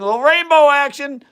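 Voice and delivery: excited. Real, not acted. Streamer marvels to viewers at the rainbow, excited. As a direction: cheer on the rainbow in the flask. Repeats, then louder and higher.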